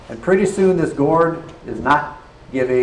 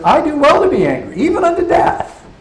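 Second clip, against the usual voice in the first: sulking Jonah; 12 semitones higher, 3 dB louder than usual. Acted. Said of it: "Jonah's question, answer is".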